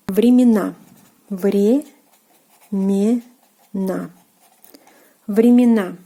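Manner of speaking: времена repeated clearly with stress on the last syllable; Russian pronunciation demo. Shown as a demonstration. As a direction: drill времена for learners. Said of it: "the plural form".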